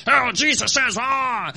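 loud, mock pulpit bellow; preacher's yell. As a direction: yell it into the microphone, hammed up. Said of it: "yell in the microphone and go".

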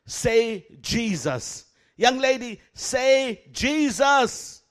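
very slow, loud, commanding; stern command. Retold or imitated. Preacher ordering the possessed girl, slow and forceful. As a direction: drag out each word as an order.

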